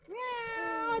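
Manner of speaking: high playful cat meow; sing-song kitty meow; mock meow. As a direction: meow at the baby like a kitten. Mother meowing to baby.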